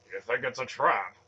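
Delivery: loud, rumbling suspicion; rumbled. A narrator's reading. Warrior suspecting a trap at the door, rumbled question. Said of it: "Varric rumbled".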